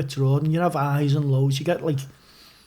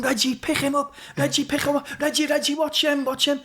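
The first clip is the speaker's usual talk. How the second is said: much higher, yelling across the pitch; calling out orders; 9 semitones higher than usual. Retold or imitated. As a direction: shout instructions from goal at your defender. Goalkeeper calling to defender Reggie.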